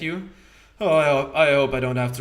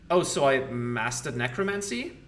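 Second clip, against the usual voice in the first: ordinary delivery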